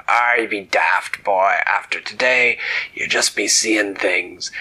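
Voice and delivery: loud, slow pirate growl; gruff pirate captain. Acted. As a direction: scoff at the boy like an old sea dog. Pirate captain scolds boy.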